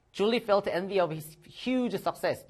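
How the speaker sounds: very slow, careful read-aloud; slow model reading. Shown as a demonstration. Teacher reading an example sentence slowly, carefully. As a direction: read it out slowly for students to repeat.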